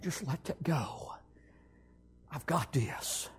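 low whisper, very soft; whispered words of Jesus to a frightened man; whispered divine voice. Retold or imitated. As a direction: breathe it like a gentle command. Voicing the Lord's still voice.

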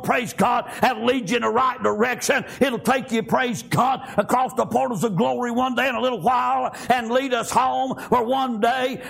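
driving preacher chant with gasped "uh" between phrases; full-force preaching. Real, not acted. Preacher proclaiming glory to the congregation, full-force preaching. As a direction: drive each phrase hard in a rhythmic cadence.

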